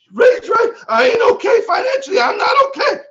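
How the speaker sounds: very high, loud imitated yell; worked-up agreement. Speaker voicing a teammate agreeing with him, high excited yell. Acting out Dwight agreeing with Ray.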